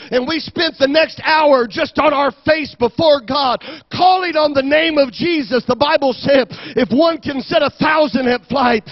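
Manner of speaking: high, forceful declaration of faith; impassioned preaching. Real, not acted. Pastor assuring the worried father through prayer, impassioned preaching. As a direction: build assurance as if preaching to a crowd.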